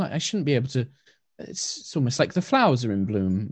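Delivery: high, soft, hesitant elf calling out; soft-voiced elf woman. Blind wood elf calls out about smashing, soft high voice. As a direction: call out gently, unsure what broke. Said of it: "in a very soft voice".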